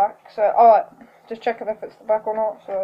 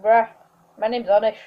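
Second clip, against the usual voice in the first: ordinary delivery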